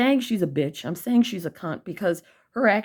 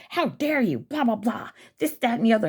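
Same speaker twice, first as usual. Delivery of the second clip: mocking Susan; 5 semitones higher than usual. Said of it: "her ugly ass goes".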